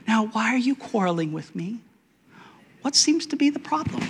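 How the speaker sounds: a hint of a gentle God asking; mild gentle questioning. God mildly asks Moses what's wrong, soft and high.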